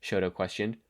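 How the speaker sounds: usual speaking voice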